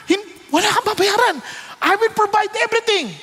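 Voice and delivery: high, insistent acted giving; eager generous offer. Preacher plays the man giving all to King David, excited and high.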